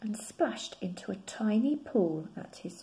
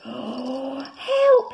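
much higher, louder cry for help; frightened shout; 5 semitones higher, 9 dB louder than usual. Acted. Rabbit cries for help as his ears twirl. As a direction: yelp in panic. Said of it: "he shouted".

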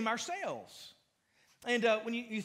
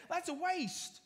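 higher, dismissive scoff; mock disciples sneering at the gift; 7 semitones higher, 5 dB quieter than usual. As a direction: sneer it like someone who sees no value. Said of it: "these disciples said".

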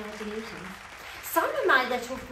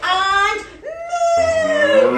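loud far animal moo and yell; loud moo and cry; 6 semitones higher, 13 dB louder, much slower than usual. Acted. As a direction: scare him with a big moo. Animals scare farmer.

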